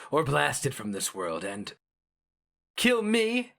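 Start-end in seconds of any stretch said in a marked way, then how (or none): 2.8-3.5 high, thin, frightened squeak; unmanly frightened squeak. A narrator's reading. Sheo Narnra facing death, high frightened squeak. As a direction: voice jumps up in fear. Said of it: "his tone far from manly".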